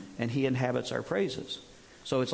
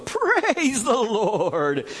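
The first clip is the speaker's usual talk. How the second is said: dragged-out preacher praise; 6 semitones higher, 6 dB louder, much slower than usual. Shown as a demonstration. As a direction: Praise the Lord stretched very slow, higher and louder with a laugh. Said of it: "drag it out and add extra syllables".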